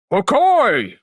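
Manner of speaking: big swooping villain cry; loud, drawn-out exclamation. Shown as a demonstration. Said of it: "a little bit more deeper".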